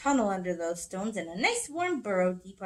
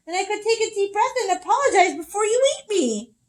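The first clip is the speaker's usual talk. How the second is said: high strained pleading, louder; pinned pleading; 9 semitones higher, 8 dB louder than usual. Acted. Squirrel squashed under Bear's paw, high strained plea. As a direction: plead breathlessly, rising high.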